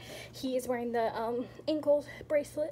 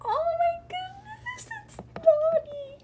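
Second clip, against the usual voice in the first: teary squeal; 3 dB louder, slower than usual. Real, not acted. Opens last figure, overwhelmed.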